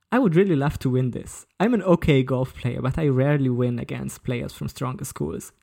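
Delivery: normal talking voice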